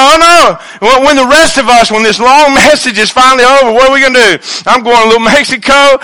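high, loud mock complaint about the long sermon; hammed-up bored congregant; mock whiny churchgoer. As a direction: whine like someone stuck in church. Preacher mocks bored churchgoers.

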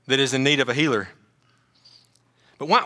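ordinary talk, nothing marked

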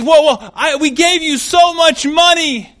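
high, loud movie-victim pleading; hammed-up indignant panic; panicked protest. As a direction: protest in alarm, voice shooting up. Acted protest to the villain.

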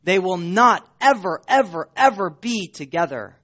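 loud stress on "not ever, ever, ever"; emphatic never. Real, not acted. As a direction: pound each "ever". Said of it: "It is emphatic".

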